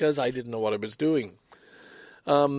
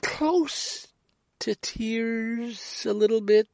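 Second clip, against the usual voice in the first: near tears; 10 semitones higher, much slower than usual. Real, not acted. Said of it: "I got really kinda close to tears".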